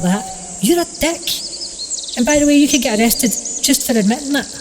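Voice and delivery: high-pitched, appalled telling-off; acted indignation, pitch well up; indignant scolding. Alex scolds creature after confession.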